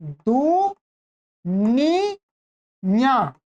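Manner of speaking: "दुनिया" very slowly in three separate drawn-out syllables. Shown as a demonstration. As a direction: break "दुनिया" into du, nii, yaa with long pauses. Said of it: "क्या सही क्या होना चाहिए".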